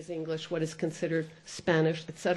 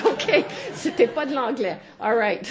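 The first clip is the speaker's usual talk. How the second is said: 1 laughing concession; 3 semitones higher, 3 dB louder than usual. Retold or imitated. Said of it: "je dis".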